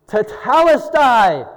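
slow, high, loud shout of Tetelestai; victory shout. Acted. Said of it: "This was a shout of victory".

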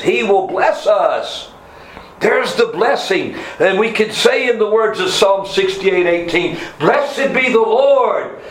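high, ringing sermon delivery; fervent preaching. Real, not acted. Preacher proclaiming God's blessing to the congregation, fervent and high. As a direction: proclaim the blessing with rising fervour.

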